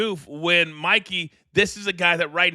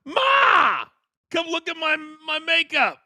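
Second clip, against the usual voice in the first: very high, loud, hammed-up man-child yelling for Ma; mock fan calling his mom; 14 semitones higher, 4 dB louder than usual. Fan in costume calling his mother, high mock yell. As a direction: squeal up for mom like an excited overgrown kid. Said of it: "he's like".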